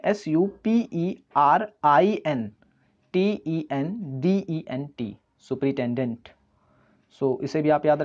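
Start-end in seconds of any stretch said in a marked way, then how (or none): 0.0-5.2 slow spelling. Shown as a demonstration. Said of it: "इसकी spelling है".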